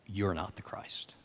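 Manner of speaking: hushed, breathy whisper to the listeners; soft unvoiced whisper, pointed at them. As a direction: lean in and whisper it like a secret. Pointed aside to the congregation.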